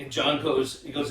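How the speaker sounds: ordinary talk, nothing marked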